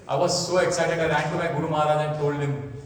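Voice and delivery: normal talking voice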